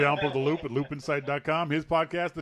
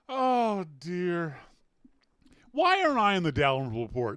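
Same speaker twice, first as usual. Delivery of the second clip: usual speaking voice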